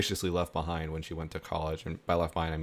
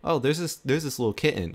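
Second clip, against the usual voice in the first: higher-pitched retold noticing; mock discovery; 6 semitones higher than usual. Family first spotting a stray kitten, mock surprised discovery. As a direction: lift the pitch as if just spotting it.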